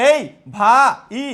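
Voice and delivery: ভা, pause, then ই, loudly; wrongly split word. Shown as a demonstration. Teacher mocking how nobody calls a brother, ভা ই split. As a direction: call out ভাই broken in two. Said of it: "আমরা কি কখনো বলি".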